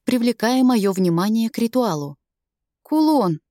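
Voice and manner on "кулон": higher, bleating nervous cry. Acted. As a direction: bleat it out timidly. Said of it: "проблеял Сэм".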